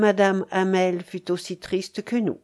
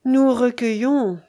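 slow, louder exclamation; raised, declamatory outcry; crying out; 4 semitones higher, 5 dB louder than usual. A narrator's reading. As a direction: cry it out, higher and drawn out. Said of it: "m'écriai-je".

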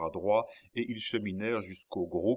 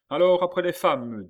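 very high, loud command; brusque rebuff; 10 semitones higher, 11 dB louder than usual. A narrator's reading. Surgeon putting women before men, loudly brusque. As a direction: call it out over the pleading.

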